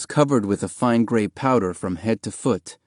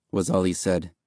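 plain reading voice

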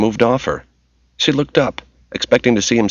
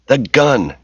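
snapped; 6 semitones higher than usual. A narrator's reading. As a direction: bark the order impatiently. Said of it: "Kim snapped".

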